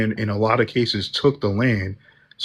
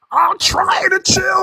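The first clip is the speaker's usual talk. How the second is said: mocking high voice; slower than usual. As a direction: parrot the line back, way up high. Parrots the line back mockingly.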